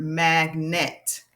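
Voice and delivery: "magnet" slowly, in two distinct halves; syllable by syllable. Shown as a demonstration. Teacher introduces the example word, "magnet" slowly split by syllable. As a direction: separate "magnet" into mag and net. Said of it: "word parts that we hear in words".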